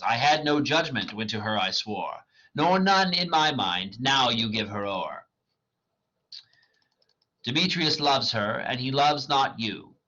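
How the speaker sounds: dramatic reading of verse; reading Shakespeare aloud. Acted. Reader voicing Hermia rejecting Demetrius, dramatic. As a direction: read the lines as the character, measured. Lysander's lines read.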